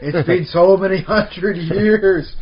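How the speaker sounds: loud, drawn-out exasperated exclamation; hammed-up relieved shout, slow and loud; mock exasperated cry. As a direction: cry it out like a villager fed up with waiting. Mock exasperated villager outburst.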